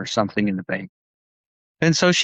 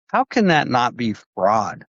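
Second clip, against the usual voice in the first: normal talking voice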